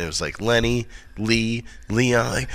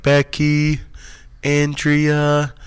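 higher, level calling-out of names; roll-call voice; 6 semitones higher, much slower than usual. Retold or imitated. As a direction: call each name on a held note, like attendance. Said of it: "when they do the name roll call".

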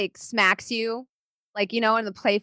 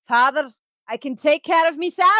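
high, raised, insistent; indignant daughter; 7 semitones higher, 5 dB louder than usual. Acted. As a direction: insist she can look after herself.

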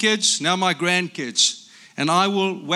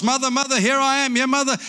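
eager calling; 9 semitones higher than usual. Acted. Baby bird runs to the snort.

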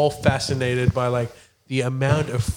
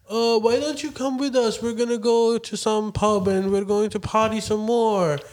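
higher-pitched imitation of inviting girls; mock party girls; 5 semitones higher than usual. Backpacker girls inviting the guys to party, mock high-pitched coaxing.

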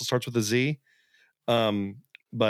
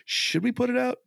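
high mock question; 13 semitones higher than usual. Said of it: "I'm like".

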